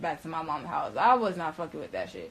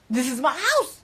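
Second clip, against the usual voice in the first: high, loud insistence; acted-out indignant outburst; retold indignant protest; 6 semitones higher, 6 dB louder than usual. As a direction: stand your ground, voice raised. Acted protest over leaving house.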